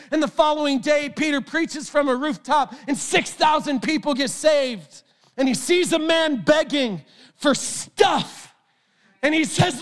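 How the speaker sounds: high, impassioned preacher voice, often shouting; full-force preaching. Acted. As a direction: preach it with fire to the crowd. Preaching to congregation, then podcast outro.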